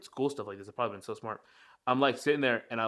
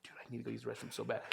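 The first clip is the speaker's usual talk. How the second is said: fast urgent whisper; hurried whisper, desperate to find a toilet; 2 semitones lower, 16 dB quieter, faster than usual. Retold or imitated. Retold urgent thought in store.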